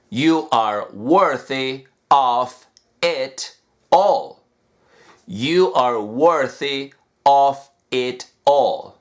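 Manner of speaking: slow, every word separated; said slowly. Shown as a demonstration. Teacher slows the phrase down for students. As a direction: take it slowly, unlinked. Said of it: "lo puedo decir totalmente despacio".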